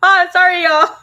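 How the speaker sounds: high, loud crying apology; real tears, apologizing through a sob. Real, not acted. Apologizing to her viewers through real tears, crying. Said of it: "I'm getting emotional".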